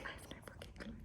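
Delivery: breathy whispered aside; a whispered I have no clue; whispered exasperation. Retold or imitated. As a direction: whisper it like a confession you're fed up. Reply to friend, exasperated aside.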